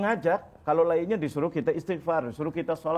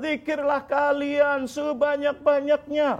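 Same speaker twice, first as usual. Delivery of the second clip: fervent exhortation; 10 semitones higher, slower than usual. Real, not acted. Preacher exhorting congregation.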